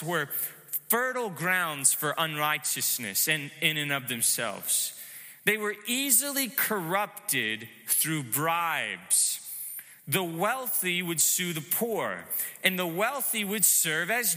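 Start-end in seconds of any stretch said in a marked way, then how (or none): none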